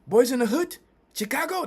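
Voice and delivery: high, loud excited exclamation; excited locals. Retold or imitated. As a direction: mimic their sudden eager recognition. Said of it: "when you find out I'm from America".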